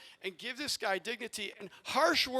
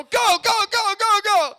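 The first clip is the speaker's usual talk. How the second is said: retold sideline yell, much higher and louder; 13 semitones higher, 14 dB louder than usual. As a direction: shout at the frozen kid to run. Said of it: "So I go".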